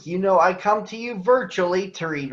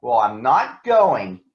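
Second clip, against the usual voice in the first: defiant refusal; 7 semitones lower, 3 dB louder, slower than usual. Acted. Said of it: "Mowgli stormed off".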